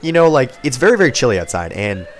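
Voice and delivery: ordinary talk, nothing marked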